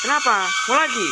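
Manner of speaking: loud, high shouted challenge; angry threat. Acted. Husband threatening another slap, shouting angrily. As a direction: threaten her at full voice.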